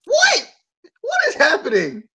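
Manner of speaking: very high, incredulous outburst; bewildered. Real, not acted. Host baffled by the wrestling storyline, high-pitched bewilderment. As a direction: squeak up high in total disbelief. Disbelief at match in woods.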